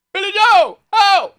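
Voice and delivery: high shrieking shout; shrill shouted callout; movie line shout. Retold or imitated. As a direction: yell it like the background extra. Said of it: "And then you hear".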